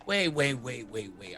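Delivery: ordinary delivery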